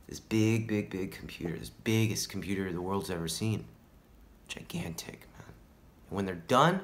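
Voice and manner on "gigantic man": soft, high whisper; hushed awe. Acted. Stoner describing the government's giant computer, hushed awe. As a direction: drop to an awed hush. Awed aside about huge computer.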